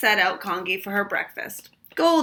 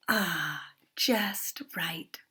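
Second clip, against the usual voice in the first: soft, sighing whisper of content; sighed; 3 semitones lower, 5 dB quieter, much slower than usual. A narrator's reading. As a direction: breathe it out, just right. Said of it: "she sighed".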